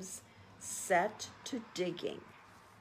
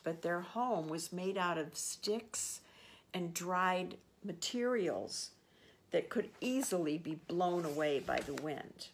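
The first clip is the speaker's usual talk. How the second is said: ordinary delivery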